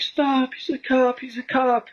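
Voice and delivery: high, drawn-out mock yell; imitated yelling. Retelling friends' warning during a brawl, imitated yelling. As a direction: call it out slowly, way up high, like a retold warning. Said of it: "their friends were yelling".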